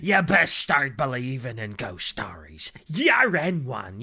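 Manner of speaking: lightly voiced pirate growl; slow, loud hint of a pirate captain. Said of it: "he sounds like a pirate".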